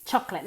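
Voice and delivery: "Chocolate" joined up as CHOC-let; two syllables. Shown as a demonstration. Teacher shows students the English word, two-syllable chocolate. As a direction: run "Chocolate" together in two syllables, no middle vowel. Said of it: "Dos sílabas".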